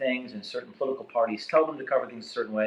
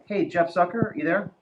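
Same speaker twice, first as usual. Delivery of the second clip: raised, high call into the phone line; raised voice asking who's on the line; 8 semitones higher than usual. Real, not acted. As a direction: call out to check if he's there. Calling into the conference line.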